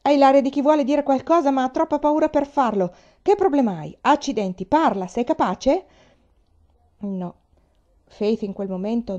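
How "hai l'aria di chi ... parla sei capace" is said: raised, impatient bullying tirade; thundering. A narrator's reading. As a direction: badger the child at high pitch. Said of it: "tuonò".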